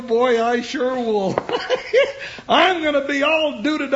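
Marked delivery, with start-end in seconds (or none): 1.4-2.4 delighted giggle. Acted. Jingles giggles with joy.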